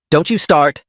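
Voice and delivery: mock-stern aunt cutting her off; snapped. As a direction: snap it at her to shut her up. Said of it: "her mother snapped".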